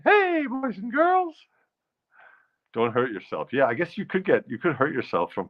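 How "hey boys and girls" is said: high, slow cartoon mouse voice; Mickey Mouse voice. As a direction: greet the kids like a cartoon mouse. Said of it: "Mickey Mouse talked like that".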